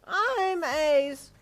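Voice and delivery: put-on high, loud child claim; boasting little boy. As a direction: butt in like a cocky toddler. Children squabbling.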